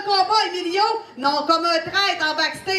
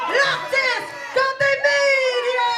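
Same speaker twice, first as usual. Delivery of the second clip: big shouted match announcement; announcing shout; 6 semitones higher, slower than usual. Acted. Announcing the main event match.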